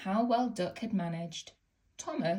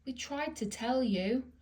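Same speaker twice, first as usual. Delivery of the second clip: mild sob; 6 semitones higher than usual. A narrator's reading. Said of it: "sobbed Annie and Clarabel".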